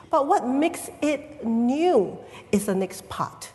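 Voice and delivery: normal talking voice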